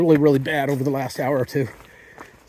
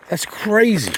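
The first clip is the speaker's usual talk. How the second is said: exasperated disbelief; 6 semitones higher, slower than usual. Real, not acted. Exhausted after 25 miles.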